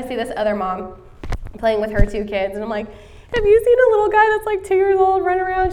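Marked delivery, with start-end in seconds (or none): 3.3-5.7 high, fast and louder panicked question; panicked mother asking another mom about her missing toddler; frantic asking. Retold or imitated. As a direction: blurt it out in a panic. Frantic mother asks a stranger.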